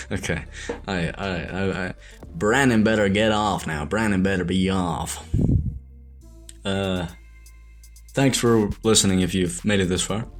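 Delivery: usual speaking voice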